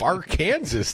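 Arkansas said as ar-KAN-zus, like Kansas; alternative pronunciation. Shown as a demonstration. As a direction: say Arkansas with the final S sounded. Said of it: "depending on how you pronounce it".

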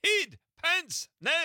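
high, loud imitated Scottish shout; broad scottish accent. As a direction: bark it like a Scottish dad. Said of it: "He was like".

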